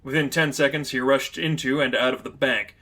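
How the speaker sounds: usual speaking voice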